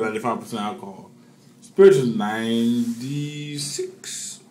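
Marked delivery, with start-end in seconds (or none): 1.6-4.4 drawn out and louder. Real, not acted. Drunk emphatic correction.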